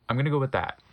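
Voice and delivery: plain reading voice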